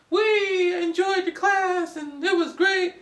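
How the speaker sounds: high, slow, teary mock sob; high wobbly crying student voice. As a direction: imitate students weeping their thanks. Students thanking teachers while crying.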